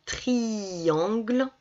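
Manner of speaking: "triangle" very slowly, long i into nasal an; drawn-out syllables. Shown as a demonstration. Teacher saying the word so pupils hear the sound, drawn out. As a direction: stretch the tri-an of "triangle". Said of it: "j'entends ion".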